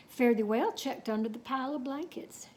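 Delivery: ordinary delivery, nothing marked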